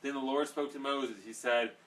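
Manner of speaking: Bible reading aloud. Acted. Reading Exodus aloud to the group, loud and proclaiming. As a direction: proclaim the passage to the room.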